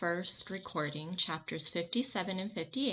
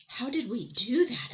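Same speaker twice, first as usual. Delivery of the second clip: louder, eager question; excited question; 5 semitones higher, 5 dB louder than usual. Acted. Boy amazed after landing, excited. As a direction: blurt it out in wonder.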